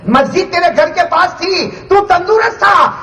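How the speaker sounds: high, forceful preacher's accusation; thundering reproach. Acted. Preacher voices God's reproach. (اونچی آواز میں پرجوش ملامت)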